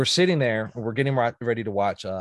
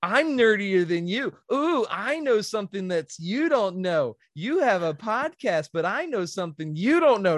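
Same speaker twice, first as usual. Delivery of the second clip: sing-song taunt; 8 semitones higher than usual. Retold or imitated. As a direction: tease like a schoolyard brat. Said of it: "Yeah, she's like".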